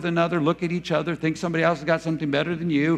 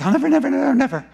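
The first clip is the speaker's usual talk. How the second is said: high, pressed repeated never; mock earnest promise; 8 semitones higher, 4 dB louder, much faster than usual. Sinner making eager promises to God, high and earnest. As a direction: overpromise earnestly. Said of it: "we make all those promises".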